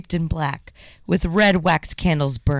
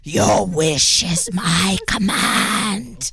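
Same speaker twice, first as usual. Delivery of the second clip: slow booming spirit voice; genie voice; slower than usual. Acted. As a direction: intone it like a genie. Spirit grants the wish.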